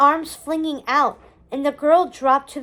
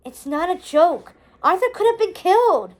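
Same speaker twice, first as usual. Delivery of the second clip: higher, faster, heated protest; indignant retort; 5 semitones higher, 3 dB louder than usual. A narrator's reading. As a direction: snap it back, full of indignation. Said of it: "her voice rich with indignation".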